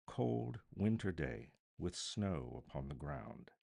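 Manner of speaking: plain reading voice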